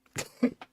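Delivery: a short burst of breathy laughter; quick amused breathy laugh. Real, not acted. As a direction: laugh at your own joke. Laughs after own parody.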